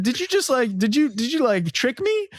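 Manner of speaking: high, stunned voice of a tricked opponent; high disbelieving opponent. Retold or imitated. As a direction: squeak it out in disbelief. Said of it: "he's like".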